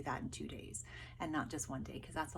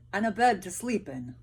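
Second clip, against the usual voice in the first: louder, eager soldier voice; weary soldier adding his wish; second soldier; 9 dB louder than usual. Acted. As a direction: chime in wanting a bed.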